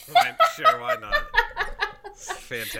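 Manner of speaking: high squeaky laughing sarcasm. Real, not acted.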